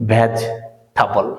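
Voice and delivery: ভেজিটেবল said slowly as /bet͡ɕʈapo/, stretched syllable by syllable; slow correct pronunciation. Shown as a demonstration. Teacher drilling students, slowly sounding ভেজিটেবল. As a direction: spell out ভেজিটেবল slowly with clipped ending. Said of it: "এটার শুদ্ধ উচ্চারণ হবে".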